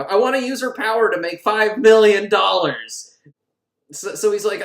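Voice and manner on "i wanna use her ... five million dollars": higher, louder greedy declaration; hammy scheming proclamation; mock villain boast. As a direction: build to a grand villainous "five million dollars". Villain's greedy demand, retold.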